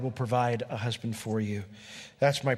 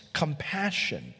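normal talking voice

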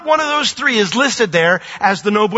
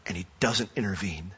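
hushed, solemn; 7 semitones lower, 9 dB quieter than usual. Real, not acted. Solemn climax about the cross.

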